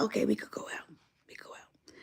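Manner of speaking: hushed whisper. Retold or imitated. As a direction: breathe it so dad won't hear. Quiet aside to friends.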